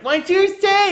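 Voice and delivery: high, loud mock scolding; hammed-up outraged mother; yelling mom. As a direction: screech it like a caricature mom catching a curse. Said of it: "and she yells at you".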